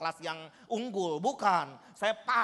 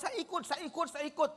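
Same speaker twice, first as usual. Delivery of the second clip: eager tag-along; 10 semitones higher than usual. Retold or imitated. Eager follower echoing.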